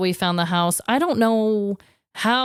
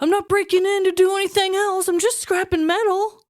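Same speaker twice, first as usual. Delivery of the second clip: much higher, innocent-sounding mock voice; mock burglar excuse; 11 semitones higher than usual. Copper thief excusing himself to police, high innocent mock voice. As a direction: play the thief all innocence.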